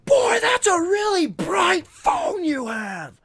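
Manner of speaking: high, drawn-out yell; shouted callout. Retold or imitated. Calling out a phone user in the theater, shouting. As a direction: bellow it slowly across a big room.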